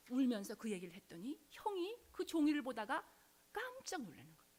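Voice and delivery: ordinary delivery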